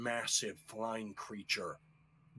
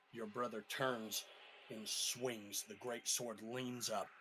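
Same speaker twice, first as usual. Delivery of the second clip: ordinary talk, nothing marked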